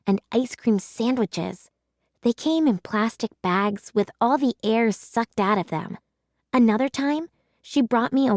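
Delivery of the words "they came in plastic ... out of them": plain reading voice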